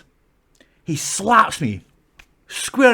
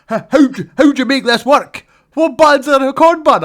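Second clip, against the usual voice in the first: flustered fan; 9 semitones higher, 8 dB louder than usual. Retold or imitated. Fan fumbling with the camera.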